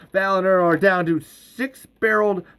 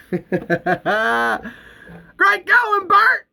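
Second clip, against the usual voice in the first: cheering; 8 semitones higher, slower than usual. Acted. Cheering Bert.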